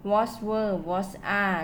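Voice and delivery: very slow, each word set apart for students; slow model reading. Shown as a demonstration. Teacher to class reading example openers, very slow. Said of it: "ตัวอย่าง".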